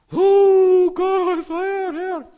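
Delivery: high anguished wail to God; frantic high cry of Herr, Herr; desperate cry. Acted.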